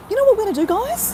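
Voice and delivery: high and loud, bursting with an idea; excited. Real, not acted. Telling viewers about a sudden plan, excitedly high and loud. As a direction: sell the idea like it's the best ever.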